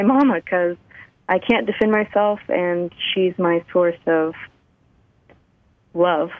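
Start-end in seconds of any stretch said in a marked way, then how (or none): none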